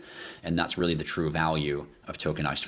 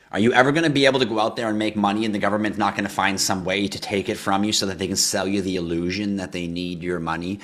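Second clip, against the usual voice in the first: usual speaking voice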